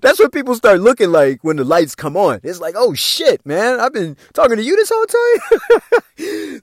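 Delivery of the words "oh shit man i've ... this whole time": high, slow startled gasp of a voice; shocked realization. Retold or imitated. Lights come on, high startled realization. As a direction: recoil at the sight of them. Realizing who you talked to.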